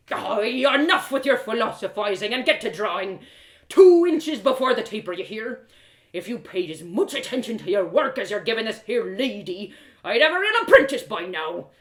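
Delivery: put-on loud, rough old smith barking at his apprentice; a burly smith losing patience, loud; gruff blacksmith, scolding.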